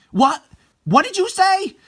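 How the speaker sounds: high, loud shocked cry; shocked outburst. Acted. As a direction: shriek in disbelief. Nana hears about the breakup.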